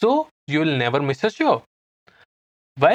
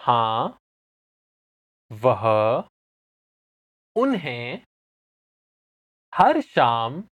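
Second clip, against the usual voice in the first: one word at a time, long pauses between; spaced-out words, last phrase run together; slow repeat. Shown as a demonstration. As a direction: leave gaps for the learner to repeat. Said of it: "I'll say it again slowly. Just repeat it".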